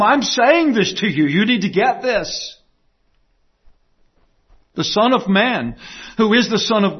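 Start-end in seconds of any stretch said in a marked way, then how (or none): 0.0-2.6 "I'm saying this to you" pressed emphatically at a raised pitch; hammering it home. Retold or imitated. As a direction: drive home "You need to get this" with firm, higher-pitched stresses. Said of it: "he wants to hammer this home".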